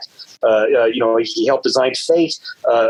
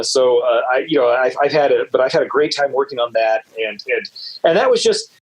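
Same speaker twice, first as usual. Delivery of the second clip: ordinary talk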